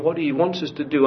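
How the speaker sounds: ordinary talk, nothing marked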